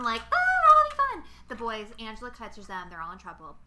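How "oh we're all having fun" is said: very high, loud singsong; mock carefree fun. Mimicking the panty raid crowd, squealy glee. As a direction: bubbly fake cheer. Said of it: "this fun".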